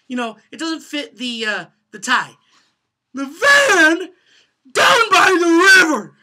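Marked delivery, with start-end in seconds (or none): 3.1-6.2 high, loud, slow "down by the river" with a break; full cracking shout. Shown as a demonstration. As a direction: give "The van down by the river" everything. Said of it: "that doesn't give off".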